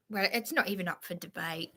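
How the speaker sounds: fast, soft whisper to herself; whispered aside. Real, not acted. Muttering aside to herself about the troll, whispered. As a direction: drop to a quick whisper under the breath.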